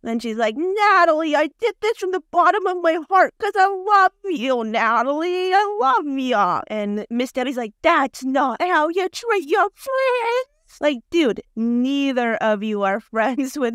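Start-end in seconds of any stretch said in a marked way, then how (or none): 0.5-6.6 hammed-up, high declaration of devotion; mock heartfelt plea. Mimics Debbie pleading devotion to Natalie. 7.8-10.5 high, slow, wailing retort; mock tearful reproach. Mimics Miss Debbie's tearful retort.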